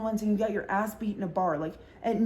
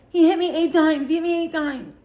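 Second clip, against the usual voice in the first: much higher, louder mock drunk whine; drunk insisting; 7 semitones higher, 6 dB louder than usual.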